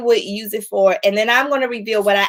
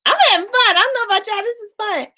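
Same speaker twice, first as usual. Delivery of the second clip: excited; 9 semitones higher, faster than usual. Real, not acted. Enjoying the live game.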